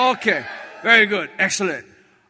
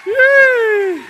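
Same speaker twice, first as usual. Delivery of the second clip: mock cheer; 14 semitones higher, 8 dB louder than usual.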